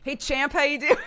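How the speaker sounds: hearty US dad checking in; American dad greeting. Pretend. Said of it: "That one's so American".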